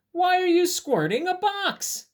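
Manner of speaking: very high, loud incredulous question; over-the-top puzzled storybook asking. Acted. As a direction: squeal the question in disbelief. Reads the question to ask bunny.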